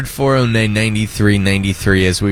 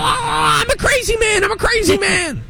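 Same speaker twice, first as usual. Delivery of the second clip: mock crazy yelling. Host role-plays a line cutter at Disney, high crazy yell. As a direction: play a ranting line cutter, pitched way up. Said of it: "What if I just start yelling".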